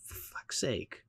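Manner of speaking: breathed under the breath, exasperated; grumbling to yourself about a ruined moment; muttered curse. Real, not acted. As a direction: let it slip out under your breath, fed up. Exasperated aside, annoyed at film.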